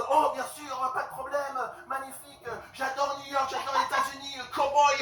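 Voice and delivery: high, excited French fawning; gushing French clerk. Retold or imitated. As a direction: gush at an American tourist. Clerk gushes to supposed American.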